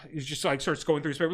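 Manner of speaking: normal talking voice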